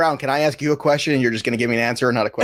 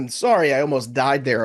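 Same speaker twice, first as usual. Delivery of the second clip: usual speaking voice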